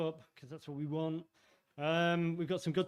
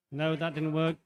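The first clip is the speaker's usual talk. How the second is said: ordinary talk, nothing marked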